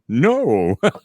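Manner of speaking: loud mock Italian refusal; hammed-up Italian accent; Italian singer accent. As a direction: object like an Italian opera star. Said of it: "as an Italian singer".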